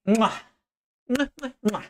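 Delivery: smacking goodbye kisses; blowing kisses. Real, not acted. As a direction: blow kisses to chat.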